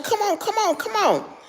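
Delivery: high pleading call; urgent pleading. Retold or imitated. To her cousin behind the door, high urgent pleading. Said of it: "So I kept on telling her".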